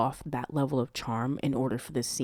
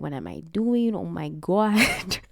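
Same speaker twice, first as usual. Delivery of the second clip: higher, drawn-out melodramatic dismay; mock panic; 5 semitones higher, 3 dB louder, slower than usual. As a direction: fret theatrically, stretching the last words. Character panicking before the date, acted.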